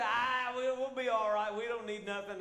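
loud, slow, high mock voice of careless men; mocking carefree men. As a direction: brush off the need, hammed up and loud. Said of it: "All those men say".

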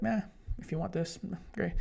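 high, shrugging meh; indifferent meh. Retold or imitated. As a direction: toss it off like you don't care. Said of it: "I'm kinda like".

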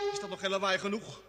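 normal talking voice

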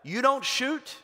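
calling back across the battlefield; yelled back. Retold or imitated. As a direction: shout it across no man's land. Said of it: "the Brits yelled back".

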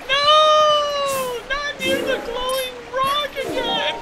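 very high falsetto wail; mock protesting female character. Companion objects to the glowing rock, high mock protest. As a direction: whine it out in a put-on squeaky falsetto. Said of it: "She's like".

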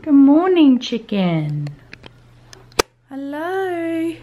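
high, loud sing-song morning hello; sing-song greeting. Real, not acted. Mum greets her waking toddler.

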